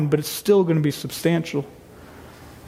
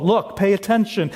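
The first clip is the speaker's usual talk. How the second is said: normal talking voice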